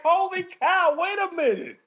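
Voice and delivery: high, stunned holy cow; retelling the sprint, high and stunned; retold shock. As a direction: pitch it way up in disbelief. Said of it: "I was like".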